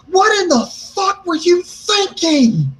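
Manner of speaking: high, drawn-out incredulous yell, retold; exasperated outburst. Driver berates his friend in the car, retold exasperated outburst. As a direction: pitch it way up and stretch each word in mock fury.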